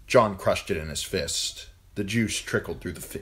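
usual speaking voice

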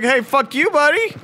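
high-pitched mock taunt, rising at the end; mock taunting game. Game insulting the player, high mock taunt. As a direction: play the game picking a fight. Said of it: "the game is like".

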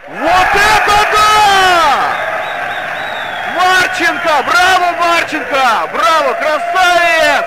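loud, slow, drawn-out goal yell; ecstatic shouting. Real, not acted. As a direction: roar out the goal celebration. Goal celebration commentary. (восторженный крик)

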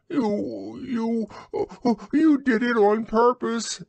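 high squeaky sputtering; spluttering Piglet. A narrator's reading. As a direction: splutter through soap. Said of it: "spluttered Piglet".

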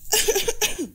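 high-pitched acted coughing fit; startled choking cough. Wife chokes at the sister-in-law's call, coughing. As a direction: cough as if suddenly choking in fright. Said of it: "سهير براب".

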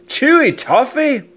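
ordinary delivery, nothing marked

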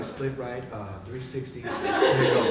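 cool, unhurried huddle call; calm. Retold or imitated. Calm quarterback calling a play to his huddle. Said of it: "this is how calm he was".

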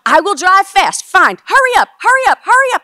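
high, full-force urgent yells; frantic screaming. Retold or imitated. Daughter screaming at her friend down the highway, frantic screaming. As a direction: scream to hurry them along. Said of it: "I'm screaming at her".

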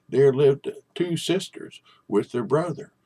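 usual speaking voice

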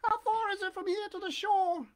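very high, eager puppet question; breathless Pinocchio. A narrator's reading. Puppet asking the pigeon how far, high and breathless. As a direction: ask urgently in a high little voice. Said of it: "asked Pinocchio breathlessly".

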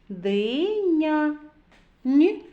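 drawn-out "Диня", then a punched "Н"; sounding out. Shown as a demonstration. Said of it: "зробимо звуковий аналіз слова".

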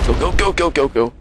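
high, rapid-fire repeated urging; urgent egging-on. Real, not acted. Player urging the game on, urgent. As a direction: spur it on, pitch jumping up.

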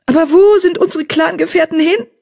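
high lamenting cry. Acted. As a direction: wail it in grief for the lost clan. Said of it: "klagte Eichhornpfote".

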